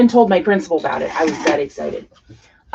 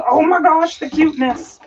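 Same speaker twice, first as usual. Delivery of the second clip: gushing over cuteness; 8 semitones higher, 3 dB louder than usual. Retold or imitated. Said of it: "you're just like".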